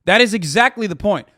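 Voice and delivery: ordinary delivery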